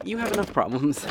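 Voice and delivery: ordinary talk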